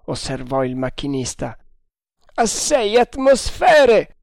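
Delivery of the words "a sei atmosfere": high, loud, slow command; shouted order. A narrator's reading. Haider ordering more pressure from the machinist, shouting. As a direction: bellow it with the last word stretched. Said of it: "gridò Haider".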